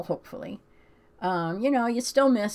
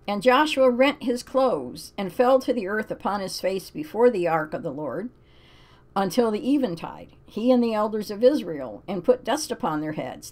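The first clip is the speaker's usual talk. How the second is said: scripture reading; 3 semitones higher, 5 dB louder than usual. Acted. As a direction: read it like a solemn verse. Reads Bible passage aloud.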